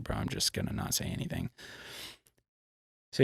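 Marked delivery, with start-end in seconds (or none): 0.0-1.5 very low, hushed murmur; low aside. Retold or imitated. Brother to rider during a tense talk, low hushed aside. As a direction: drop right down, keep it between brothers.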